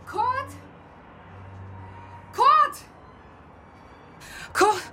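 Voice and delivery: panicked calling of Kurt; alarmed calling. Acted. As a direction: call out to the collapsed man in panic. Finding colleague collapsed, calling.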